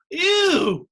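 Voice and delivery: high, loud disgusted ew; mock shouted ew. Comic quoting friends' reaction, loud disgusted ew. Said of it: "is not an appropriate response".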